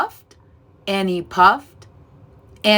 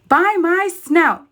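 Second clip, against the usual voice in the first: high and slow, alarmed; startled cry; 10 semitones higher than usual. A narrator's reading. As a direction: gasp it out in shock. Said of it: "cried Horace".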